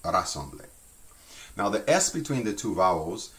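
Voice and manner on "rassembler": "Rassembler" starting on a tongue-tip R; rolled R. Shown as a demonstration. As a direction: roll the opening R of "Rassembler" lightly. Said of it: "just try rolling your R's".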